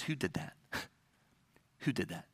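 soft, low voiced question as Jesus; hushed question. Jesus turning to ask who touched him, hushed. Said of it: "he whips her around and he says".

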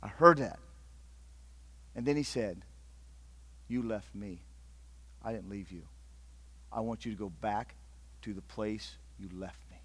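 soft, low, tender call; gentle voice of God. Acted. God calling Ron back, soft and tender. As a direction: speak like a quiet, loving summons.

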